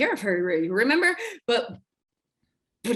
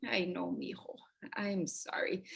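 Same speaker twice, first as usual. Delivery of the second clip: soft, hushed comfort to her son; mother hushing her upset boy, softly; tender whisper; 2 semitones lower, 12 dB quieter than usual. Acted. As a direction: whisper it gently like soothing a child.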